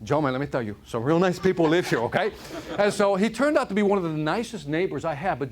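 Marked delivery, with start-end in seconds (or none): 0.0-2.3 put-on Cuban gangster accent; Tony Montana. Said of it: "go into my Tony Montana accent".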